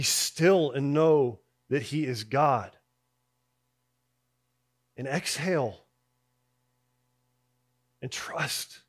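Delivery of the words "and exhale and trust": soft exhale. Acted. Leads congregation to exhale.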